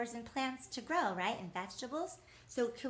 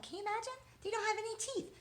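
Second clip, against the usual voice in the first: mock amazement; 12 semitones higher, much faster than usual. Teacher marvels to child.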